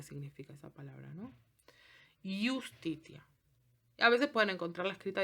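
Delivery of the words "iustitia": "Iustitia" slowly, initial I as vowel, no J sound; drawn-out teacher demo of "Iustitia"; slow Latin pronunciation. Shown as a demonstration. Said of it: "Siempre suenan I".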